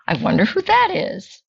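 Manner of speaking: high granny voice, wondering; Bubby Braina voice. Acted. Grandmother hearing a knock, high granny voice.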